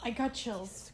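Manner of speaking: ordinary talk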